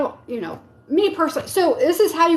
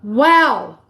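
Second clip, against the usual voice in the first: real wow; 3 semitones lower, 7 dB louder than usual. Real, not acted.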